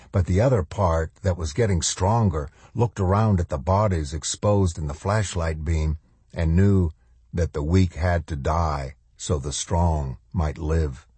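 usual speaking voice

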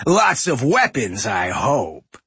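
gruff smug trade prince; raspy goblin boss. A narrator's reading. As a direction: leer and gloat, raspy. Voices Gallywix.

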